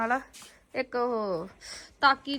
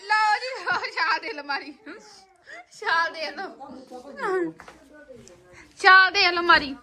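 dramatic loud aunty; 5 semitones higher, 4 dB louder, much slower than usual. Real, not acted. Urging someone to jump.

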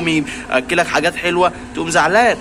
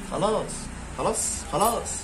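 much softer, hushed soothing to calm an animal; soft coaxing; 4 semitones higher, 12 dB quieter than usual. Real, not acted. Hushing the sulking fox so it calms down, soft coaxing. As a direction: ease it out gently, nearly under the breath.

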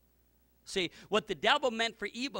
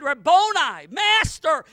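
loud, high shout of recognition; joyful cry; 9 semitones higher, 13 dB louder, slower than usual. Acted. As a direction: cry out in awe at the risen master. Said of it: "and fell at his feet".

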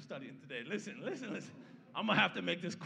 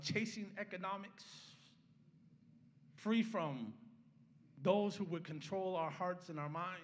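heartfelt pulpit prayer; 3 dB quieter than usual. Acted. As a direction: pray it out, building on each free. Opening prayer.

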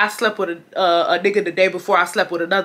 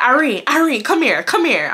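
calling her over; 5 semitones higher than usual. Retold or imitated. Acts him calling her over.

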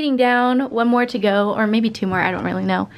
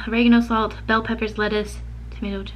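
ordinary talk, nothing marked

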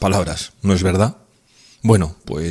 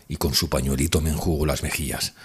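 ordinary delivery, nothing marked

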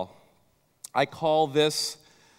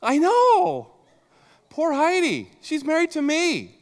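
cringing squeal; 11 semitones higher, 4 dB louder than usual. Real, not acted. Preacher cringes at own blunder.

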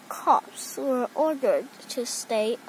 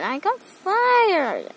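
dejected; 6 semitones higher, slower than usual. A narrator's reading. Sacked chief tells his partner.

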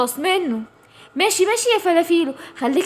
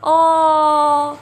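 a drawn-out cry of pain; long pained tiger roar; 4 dB louder, much slower than usual. Acted. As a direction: bellow as the rock lands. Tiger hit by rock. (صرخة ألم طويلة من النمر)

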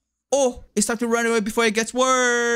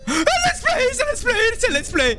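scared horror game streamer. Real, not acted. Panicked shrieking at monster.

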